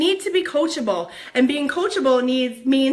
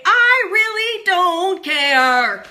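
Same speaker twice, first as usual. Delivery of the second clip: slow, higher, louder defiant drawl; defiant declaration; 5 semitones higher, 5 dB louder, much slower than usual. Acted. Telling haters she doesn't care, slow and defiant. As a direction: stretch out I really don't care. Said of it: "sassy declaration".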